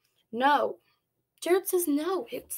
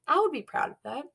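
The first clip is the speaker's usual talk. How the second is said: ordinary delivery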